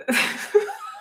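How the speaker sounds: high shrieking laugh; high squeal of laughter. Real, not acted. Reacting to viewer comment.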